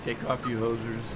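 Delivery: mock Canadian oot-and-aboot vowels; lightly hammed-up Canadian accent. Streamer teasing Canadian viewers in a put-on Canadian accent. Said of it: "I can sound a Canadian".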